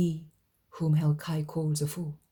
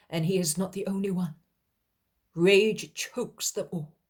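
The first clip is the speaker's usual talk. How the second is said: plain reading voice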